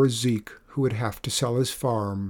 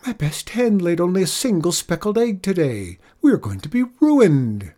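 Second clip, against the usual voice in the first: higher, plaintive storybook lament; mock weeping; 6 semitones higher, 4 dB louder than usual. A narrator's reading. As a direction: lament to the wife, hammed-up but tearless. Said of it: "Abel wept to his wife".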